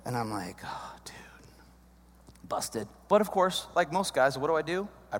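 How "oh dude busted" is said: hushed, unvoiced aside; whispered aside. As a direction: breathe it like you're caught. Caught by wife, inner whisper.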